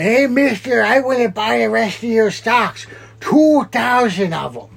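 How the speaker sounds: high, slow, put-on hobo voice; bum voice. Hobo offers to buy the stocks, high slow put-on voice. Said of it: "the bummer's like".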